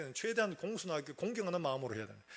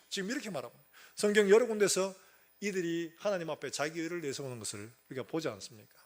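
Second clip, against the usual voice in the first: usual speaking voice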